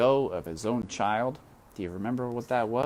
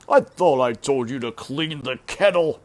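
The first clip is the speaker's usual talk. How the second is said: louder, harsher uncle voice; scolding uncle; 5 semitones higher, 9 dB louder than usual. Acted. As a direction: snap at her about the kettle.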